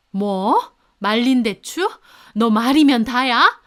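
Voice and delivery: offended mother-in-law yelling at her daughter-in-law; furious. A narrator's reading. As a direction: build from a sharp question to a full yell.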